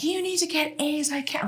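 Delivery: high-pitched mock scold; mock strict mom. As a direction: squeak out mom's demand.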